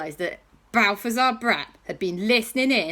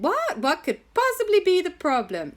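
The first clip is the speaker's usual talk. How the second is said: mock surprise; 10 semitones higher than usual. J B reacts to problem.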